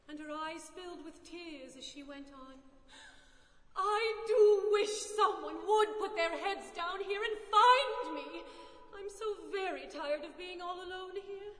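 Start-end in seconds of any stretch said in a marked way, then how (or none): 3.7-11.5 loud, drawn-out plaintive wish; lonely, upset outburst; tearful wail. A narrator's reading. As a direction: plead miserably, stretching the words. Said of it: "burst into tears".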